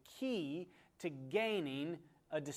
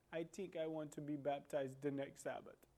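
softly voiced accented English; a light imitation of a foreign-accented woman, quietly; gentle reply in a hint of foreign accent; imitated foreign accent; 5 dB quieter than usual. Retold accented convert answers softly.

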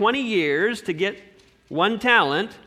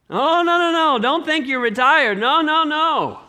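emphatic loud no; 7 semitones higher, 5 dB louder than usual. Real, not acted. Warning listeners not to relax.

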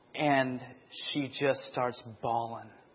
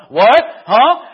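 a loud, higher startled what-huh; mock surprised dad; 10 semitones higher, 19 dB louder than usual. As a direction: play the dad caught off guard. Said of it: "and Dad not going".

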